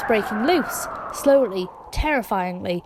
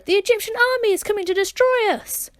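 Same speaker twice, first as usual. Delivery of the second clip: much higher frightened plea; terrified cry; 10 semitones higher than usual. A narrator's reading. People begging Moses as the army comes, terrified high cry. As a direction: plead in panic that the army is coming. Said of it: "they called in terror".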